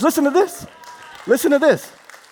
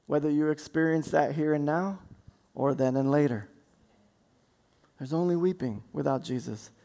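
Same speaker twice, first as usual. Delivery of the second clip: low, much softer appeal; hushed preaching; 10 semitones lower, 9 dB quieter than usual. Acted. Preacher pleading with listener, hushed and low. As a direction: lean in and speak softly.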